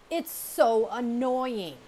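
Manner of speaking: loud, slow, fed-up complaint; annoyed. Acted. Sister bear complaining about her copycat brother, slow and loud. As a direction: drag it out, thoroughly fed up.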